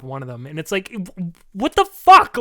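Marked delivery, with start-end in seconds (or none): 1.5-2.4 sudden high, loud what-the-fuck; exasperated outburst. Real, not acted. Griping to friends about the class schedule, exasperated shout. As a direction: blurt it out in disbelief.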